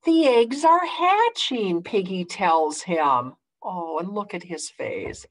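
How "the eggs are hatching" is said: high, loud hatching news; excited announcement. Acted. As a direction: announce it with delight.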